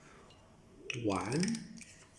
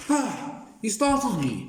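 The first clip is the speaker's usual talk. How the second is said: startled; 7 semitones higher, 3 dB louder than usual. Acted. Caught off guard by listener.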